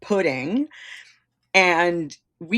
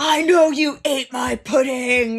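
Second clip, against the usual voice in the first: slow, high mock shout; drawn-out overacted yell; mock rant; 6 semitones higher, 4 dB louder, slower than usual. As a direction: roar the accusation in hammed-up outrage. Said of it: "he starts ranting".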